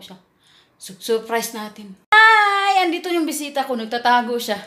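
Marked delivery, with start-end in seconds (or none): 2.1-2.8 loud, high greeting; cheery call. Real, not acted. Greeting the hiding dog to surprise it, loud and bright.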